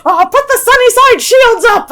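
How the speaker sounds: giddy friend. Retold or imitated. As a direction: play the friend delighting in her own pun. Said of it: "she's like".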